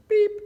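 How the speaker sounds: very high, short beep; high electronic-style blip; scanner beep. A narrator's reading. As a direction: imitate a checkout scanner's beep. Imitating the checkout scanner.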